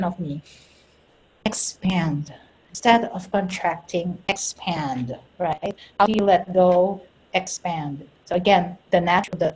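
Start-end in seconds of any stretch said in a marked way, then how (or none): 1.4-2.3 softly whispered instruction. Shown as a demonstration. Coach guiding listeners to expand, whispered. 4.3-5.2 soft low instruction. Shown as a demonstration. Guiding breath instruction. 7.3-8.1 soft low instruction. Shown as a demonstration. Guiding breath instruction.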